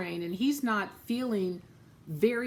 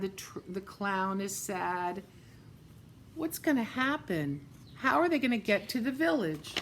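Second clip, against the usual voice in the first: plain reading voice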